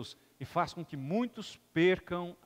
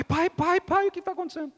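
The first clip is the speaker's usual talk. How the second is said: higher, urgent calling; frightened child; 11 semitones higher, 3 dB louder than usual. Acted. Scared boy runs to his father, frightened high calls. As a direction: cry out for dad in fear.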